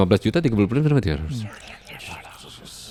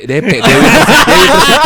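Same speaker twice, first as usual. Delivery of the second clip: laughing out the punchline; cracking up at the pun; 7 semitones higher, 9 dB louder than usual. Real, not acted. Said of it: "cerminan bapak-bapak sih".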